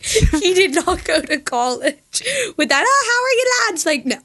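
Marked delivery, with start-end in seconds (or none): 2.9-3.8 high, lilting Irish greeting; mock Irish accent.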